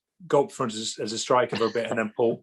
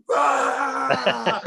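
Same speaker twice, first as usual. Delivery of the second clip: crying roar of a goal cheer, much higher; dad's cheer; 11 semitones higher than usual. Retold or imitated. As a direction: let out a wild tearful "Rah!" of joy. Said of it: "my dad just went".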